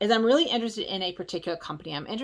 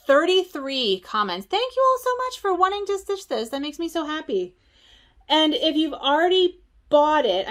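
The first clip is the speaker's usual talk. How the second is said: excited; 7 semitones higher, 4 dB louder than usual. Real, not acted. Excited reading comments.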